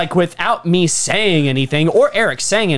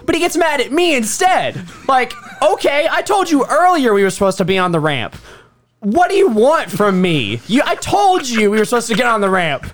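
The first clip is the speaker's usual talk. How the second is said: higher-pitched, indignant comic complaint; exasperated rant; 6 semitones higher than usual. Retold or imitated. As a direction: re-enact a fed-up argument, pitch climbing. Reenacts his exasperated quarrel with Austin.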